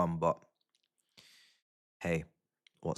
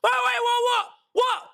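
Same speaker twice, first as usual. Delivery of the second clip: high, loud disbelieving cry; shocked shout; 12 semitones higher, 5 dB louder than usual. Real, not acted. Goal disallowed, disbelief.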